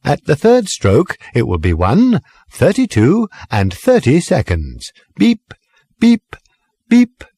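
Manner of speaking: high, stilted sing-song recorded voice; speaking clock. Acted. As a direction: sing the time like an old talking clock. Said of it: "odd, rather stilted sing-song voice".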